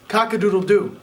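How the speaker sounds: mock rooster crow, lightly voiced. Rooster crow opens story.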